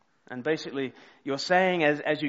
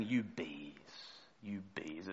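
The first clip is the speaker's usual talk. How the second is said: muttered under breath; 7 semitones lower, 13 dB quieter, slower than usual. Retold or imitated. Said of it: "say them under my breath".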